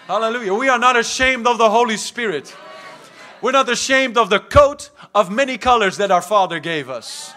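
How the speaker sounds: loud, rousing preacher, words stretched for emphasis; rally the church with loud conviction; fired-up preaching. Real, not acted. Preacher urging the church to lift hands, fired-up and loud.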